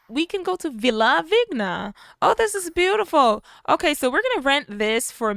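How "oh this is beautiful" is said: high and loud, thrilled; loud delighted oh; delighted gush. Real, not acted. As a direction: gush over something lovely. Gushing over the villa.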